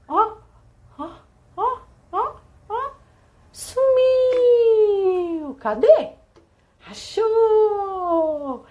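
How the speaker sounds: high playful peekaboo voice; high playful voice hiding toys from kids. Shown as a demonstration. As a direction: tease like a peekaboo game. Peekaboo game demo.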